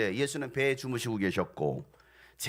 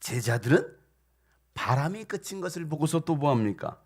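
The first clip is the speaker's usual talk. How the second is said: ordinary delivery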